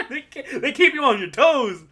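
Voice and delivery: high and laughing, "they keep you on your toes!". Real, not acted. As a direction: laugh through it in delight. Laughing at the upset.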